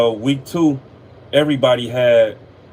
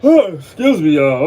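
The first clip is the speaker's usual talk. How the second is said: yawning; 3 semitones higher, 6 dB louder, slower than usual. Real, not acted. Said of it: "I'm sorry for all the yawning".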